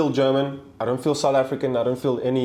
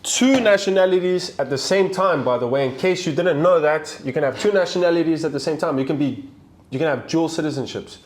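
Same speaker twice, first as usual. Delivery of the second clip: exasperated explaining; 6 semitones higher, 4 dB louder than usual. Real, not acted. Defending dual nationality.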